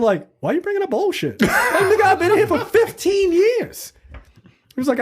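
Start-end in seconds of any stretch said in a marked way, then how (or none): 0.4-3.9 high-pitched, laughing mock outrage; indignant retort. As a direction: protest in a high, incredulous comic rant. Acting indignant retort at hearing.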